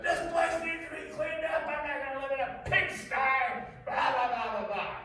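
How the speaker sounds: high, loud furious husband; mock angry rant. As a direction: lay into her about the mess. Abuser ranting at wife.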